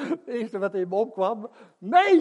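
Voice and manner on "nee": loud, high urgent no. Retold or imitated. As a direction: cut him off loudly. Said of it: "zei Jos". (luid dringend nee van Jos)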